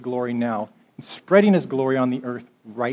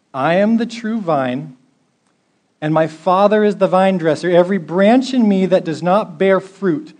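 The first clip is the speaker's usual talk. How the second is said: raised, solemn reading of Jesus' words; scripture reading; 4 dB louder than usual. Acted. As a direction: proclaim the vine passage. Reads John 15 aloud.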